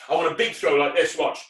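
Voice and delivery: ordinary talk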